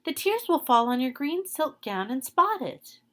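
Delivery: put-on high girl's voice calling out a warning; cried out warning. Green girl warns Dorothy about her silk gown, high. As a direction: call out quickly to stop her.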